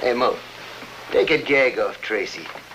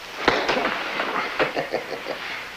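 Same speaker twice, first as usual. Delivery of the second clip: breathy villain's chuckle; soft gloating gangster laugh; evil chuckle; 4 dB quieter than usual. Acted. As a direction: chuckle to yourself, pleased with the cruelty. Gloating after ordering Tracy hurt.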